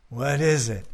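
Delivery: usual speaking voice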